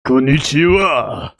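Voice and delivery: slow, thick mock Japanese accent. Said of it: "doing a racist Japanese accent".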